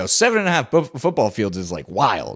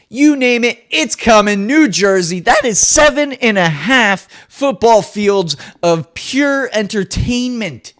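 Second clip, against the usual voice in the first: hype announcer; 9 semitones higher, 9 dB louder than usual. Acted. Hyped promo announcement.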